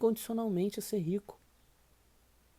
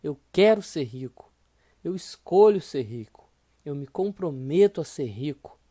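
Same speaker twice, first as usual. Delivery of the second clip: louder, emphatic repetition; loud affirmation; 8 dB louder than usual. Shown as a demonstration. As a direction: repeat the vows with force. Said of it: "E repita isso novamente".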